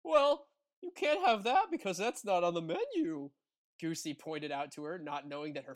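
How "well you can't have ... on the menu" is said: high, louder goose voice. Acted. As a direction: chirp it out cluelessly like a goose. Goosey voiced in read story.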